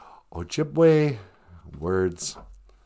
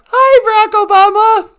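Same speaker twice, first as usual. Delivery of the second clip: high loud greeting; 11 dB louder than usual. Acted.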